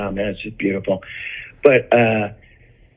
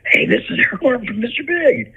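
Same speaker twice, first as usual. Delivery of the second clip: high, thrilled imitated announcement; excited introduction; 12 semitones higher than usual. Justin introducing him to his brother, imitated excitement. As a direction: pitch it way up, proudly showing him off.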